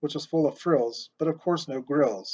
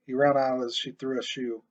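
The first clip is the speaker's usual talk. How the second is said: plain reading voice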